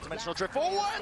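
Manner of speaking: rising shout of hype; esports caster. Real, not acted. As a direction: call the play with a burst. Caster calls sudden play.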